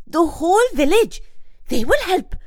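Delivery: high, loud eureka cry; excited exclamation. A narrator's reading. Boy hits on his plan, excited. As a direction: shout it like a sudden idea.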